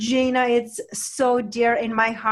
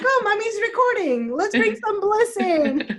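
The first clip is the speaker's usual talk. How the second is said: high, playful voicing of the baby; baby's voice; 9 semitones higher than usual. Mother voicing her baby in the background, high and playful. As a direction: put words in the baby's mouth, up high. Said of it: "It's like".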